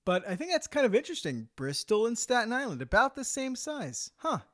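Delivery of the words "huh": usual speaking voice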